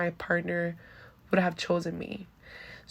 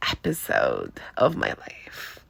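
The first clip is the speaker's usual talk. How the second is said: whispered, slow teaser; hushed dramatic reveal; 4 dB quieter, slower than usual. Real, not acted. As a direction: drop to a secretive whisper. Dramatic reveal of Ozempic episode.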